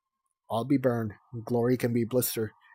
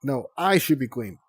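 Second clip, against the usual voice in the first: firm, raised insistence; insisting; 2 semitones higher, 7 dB louder than usual. Acted. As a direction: stake the claim over her sister. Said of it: "Tsunami insisted".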